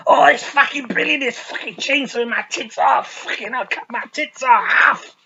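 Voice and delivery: high, loud, gleeful mock squeal; shrieking with twisted joy, way up high; mock crazy ecstasy. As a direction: ham up a deranged delight. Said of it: "She'd be like".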